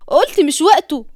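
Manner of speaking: snapping "مش وقته" loudly; angry shout. Acted. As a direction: shut him down hard. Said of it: "أول مرة تزعق فيه".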